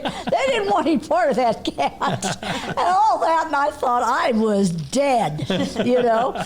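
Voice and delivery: can barely get it out for laughing; telling the punchline while cracking up. Real, not acted. Funny punchline, laughing through.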